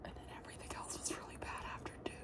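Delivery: fast unvoiced whisper. Real, not acted. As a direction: whisper it quickly, like a confession.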